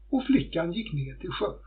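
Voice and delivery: plain reading voice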